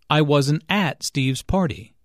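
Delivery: at stressed instead of weak, I wasn't AT Steve's party. Shown as a demonstration. Teacher showing emphasis on at meaning there. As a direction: punch the word at. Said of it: "Notice that the emphasis here is on at".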